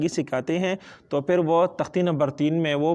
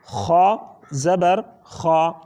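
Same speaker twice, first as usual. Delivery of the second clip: slow spelling and blending; 3 dB louder, much slower than usual. Shown as a demonstration. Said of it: "ہجا اور روانی دونوں ساتھ ساتھ".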